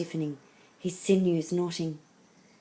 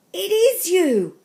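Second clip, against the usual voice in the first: high, loud, slow amazed exclamation; full of wonder; 12 semitones higher, 10 dB louder, slower than usual. A narrator's reading. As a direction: marvel at it, stretching the words. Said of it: "he said with wonder".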